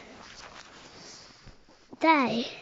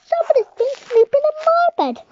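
much higher, loud, fast wail; crying baby bear; 14 semitones higher, 9 dB louder, faster than usual. Acted. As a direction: cry it out in a rush.

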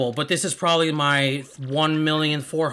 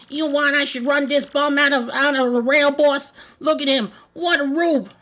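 very high, loud cartoon henchman; funny voice; 13 semitones higher, 6 dB louder than usual. Henchman offering the boss to throw the bum out, high funny voice. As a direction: squeal it like an eager mob goon. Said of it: "I wanna do some funny voices".

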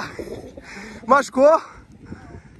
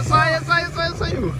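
excited; 8 semitones higher, much slower than usual. Real, not acted. Car breaks free.

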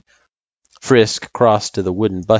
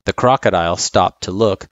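ordinary delivery

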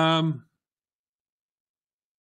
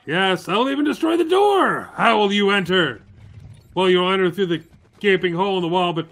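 mock villain rant. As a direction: ham up the gloating, rising to a yell. Playing Doom, villain rant builds.

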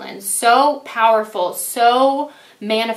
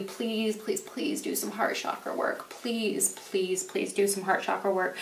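soft, whispered please, please, please; 10 dB quieter than usual. Real, not acted. Reader begging Libra viewers to do heart chakra work, whispering. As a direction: beg them gently under your breath.

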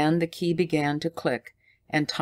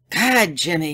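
cried out; 3 semitones higher, 5 dB louder than usual. A narrator's reading. Tommy cries out to Jimmy in alarm, louder. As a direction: blurt it in alarm. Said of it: "he cried".

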